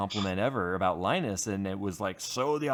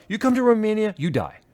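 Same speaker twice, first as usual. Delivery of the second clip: emphatic imitated warning, starting high and loud; loud high opening, dropping on the threat; mock warning; 10 semitones higher, 5 dB louder than usual. Man warning his friend about Romania, mock-ominous warning.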